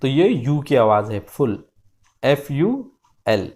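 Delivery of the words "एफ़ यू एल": normal talking voice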